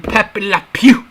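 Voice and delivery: put-on a corny French skunk accent; bad French accent. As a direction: ham up a cartoon French accent. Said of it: "With that French accent".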